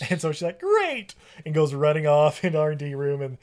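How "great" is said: a much higher, bright "Great!"; perky squeal. Retold or imitated. Claire thrilled to find Jack, perky squeal. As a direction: chirp it out delighted. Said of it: "she's super perky".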